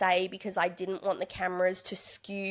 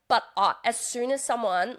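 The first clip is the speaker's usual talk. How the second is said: usual speaking voice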